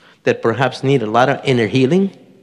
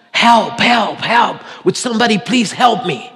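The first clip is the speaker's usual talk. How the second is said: high, calling out for help from a cliff; retold cries of a man hanging on; hanging from a branch, shouting for rescue; desperate cries for help; 6 semitones higher than usual. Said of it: "And he screamed for hours".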